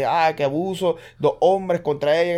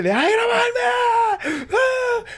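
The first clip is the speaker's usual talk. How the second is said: shrieking, much higher pitch, hammed-up rage; mock furious shout; much slower than usual. The angry woman screams at the officer to stop filming, hammed-up shriek. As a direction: yell at him like a woman losing it on camera. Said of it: "ella empezó como que a pelear".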